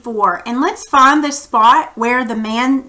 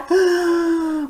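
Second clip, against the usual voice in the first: sharp worried intake of breath; anxious gasp; 6 semitones higher than usual. Acted. Teacher acting out islanders watching ponies swim, anxious gasp.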